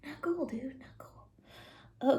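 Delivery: soft whisper, tut-tutting; hushed not cool; whispered disapproval. Real, not acted. Aside after Pharaoh's lines.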